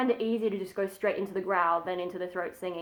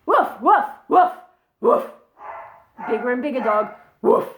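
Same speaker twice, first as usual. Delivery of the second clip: big dog bark; 6 dB louder than usual. Shown as a demonstration. Teaching the barking method, big dog woof. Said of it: "think about a bigger dog".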